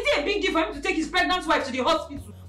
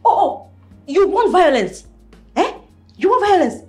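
threat; 3 semitones higher, 6 dB louder, slower than usual. Acted. Wife threatening husband.

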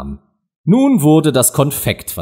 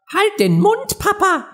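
very high, cheeky youngster; somewhat snippy. A narrator's reading. Young crab talks back to the lecturing parent, snippy. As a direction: answer back pertly, pitched way up. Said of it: "sagte der junge Krebs etwas schnippig".

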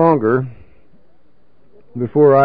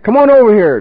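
higher, louder beckoning call; calling over; 7 semitones higher, 5 dB louder than usual. Retold or imitated. Marv beckoning his old drinking buddy, higher and louder call. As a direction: wave him over with your voice.

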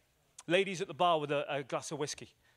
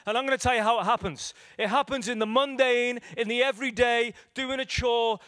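louder, higher, driving sermon climax; full-force preaching; 6 semitones higher, 6 dB louder than usual. Acted. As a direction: preach it hard to the congregation.

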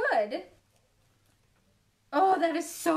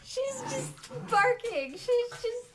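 much higher, slowed, half-laughing; amused; 10 semitones higher, slower than usual. Real, not acted. Owner describes her barking dog to viewers, amused. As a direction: tell it through amusement.

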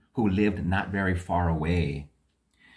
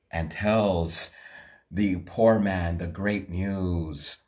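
plain reading voice

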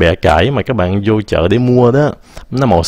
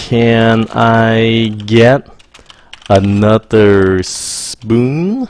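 very slow, every word drawn out; slow model phrase; much slower than usual. Shown as a demonstration. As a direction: drag "spoon" out for learners. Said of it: "hoặc là".

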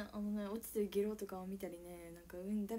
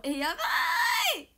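excited shriek; 11 dB louder, much slower than usual. Real, not acted. Excited reaction on stream.

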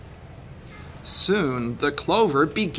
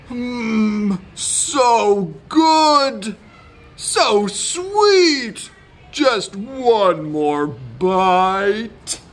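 high swoons slowing into a drawn-out last bite; savouring donkey; 5 semitones higher, 4 dB louder, much slower than usual. A narrator's reading. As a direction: relish every word as a greedy donkey. Donkey savours the stolen clover.

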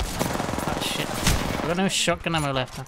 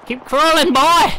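shouting; 9 semitones higher, 9 dB louder, slower than usual. Real, not acted. Urging downed teammate, loud.

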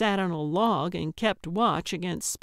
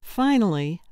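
ordinary delivery, nothing marked

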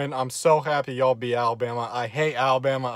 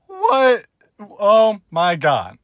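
stunned what; 6 semitones higher, 8 dB louder, much slower than usual. Real, not acted. Hearing Alabama lost.

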